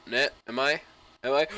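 ordinary talk, nothing marked

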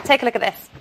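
normal talking voice

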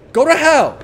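loud high yell at the thing; scared yell. Real, not acted. Player frightened by a shaking object, yelling at it. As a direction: push it away with your voice.

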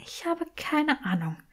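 a soft, breath-only murmur. A narrator's reading. Granger admits to Draco she has no idea, murmured. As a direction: let it slip out, utterly at a loss. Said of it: "murmelte Granger".

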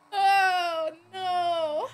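high, loud crying laughter; laughing hard. Real, not acted. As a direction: laugh until it hurts. Laughing helplessly.

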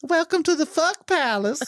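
high, smooth funk showman. Retold or imitated. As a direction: croon a groovy welcome. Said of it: "Why do I sound like [name]?".

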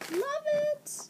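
very high squeaky love it; excited squeal. Real, not acted. Unboxing a friend's gift package, high excited squeal. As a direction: squeal it with delight.

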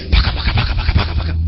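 rapid voiceless "paka paka" babble; mock tongues praying. Latecomer praying after the angel departs, frantic mock tongues. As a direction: imitate someone praying furiously in nonsense syllables.

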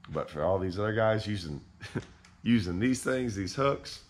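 normal talking voice

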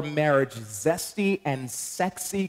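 slow, savoring emphasis; drawn out slowly. Real, not acted. Pastor teasing the church, slow drawn emphasis. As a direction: stretch it out playfully.

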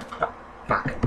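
breathy muttered oh fuck; whispered curse. Real, not acted. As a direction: breathe it out in defeat. Defeat after dying.

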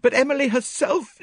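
high, loud protest cut short; agitated unfinished objection; distressed, breaking off. A narrator's reading. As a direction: start pleading, then break off. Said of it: "she broke off".

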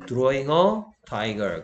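very slow, deliberate English example; slow model sentence. Shown as a demonstration. Teacher reading an example sentence to students, slowly. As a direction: spell the sentence out word by word.

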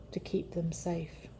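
whispered promise of safety; hushed. A narrator's reading. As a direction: drop to a whisper on the last words. Jesus's promise, softly spoken.

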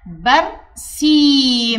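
برسيم with the long i drawn out slowly; stretched reading. Shown as a demonstration. Pupil reads the example word, long vowel stretched. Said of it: "مثل كلمة".

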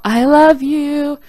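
retold fan yell; yelled I love you. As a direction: shout it across the lobby. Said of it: "and then I yell".

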